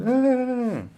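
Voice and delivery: high, loud fleeing scream; mock terrified scream. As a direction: shriek as if dogs are chasing you. Fleeing dogs, acted scream.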